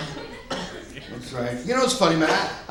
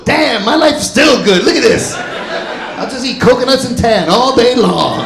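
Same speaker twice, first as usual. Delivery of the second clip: laughing contentment; 3 semitones higher, 10 dB louder, slower than usual. Pretend. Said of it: "wake up homeless and go".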